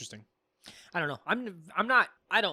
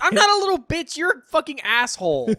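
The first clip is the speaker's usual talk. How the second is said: yelling back; 9 semitones higher, 7 dB louder than usual. Real, not acted. Mock quarrel, yelling back.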